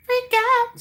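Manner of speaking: tiny, very high squeak; mock freak-out. Streamer jokes about viewers panicking, in a high mock squeal. Joke after saying don't freak out.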